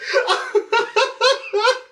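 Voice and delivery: high burst of laughter. Real, not acted. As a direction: crack up laughing. Laughing at own joke.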